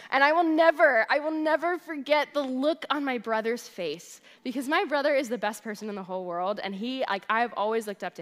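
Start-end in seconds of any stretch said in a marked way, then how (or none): none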